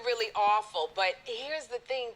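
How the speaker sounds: ordinary talk, nothing marked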